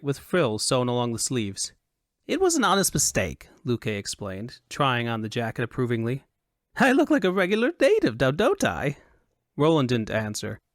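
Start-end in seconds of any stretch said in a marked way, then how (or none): 2.3-3.5 high-pitched, excitable foppish voice; high and animated Elvunian dandy; high Elvunian fop. A narrator's reading. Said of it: "he said in a high Elvunian accent". 6.8-9.0 pleased, high-pitched dandy; animated foppish preening; high Elvunian fop. A narrator's reading. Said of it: "he said in a high Elvunian accent".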